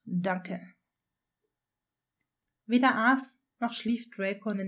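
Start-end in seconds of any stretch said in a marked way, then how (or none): 0.0-0.6 low, understated suppressed anger; through gritted teeth. A narrator's reading. Draco replying to Granger instead of shouting, tersely restrained. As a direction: force out thanks, swallowing the anger. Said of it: "erwiderte zähneknirschend".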